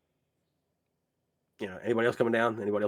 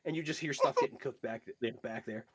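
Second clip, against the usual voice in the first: usual speaking voice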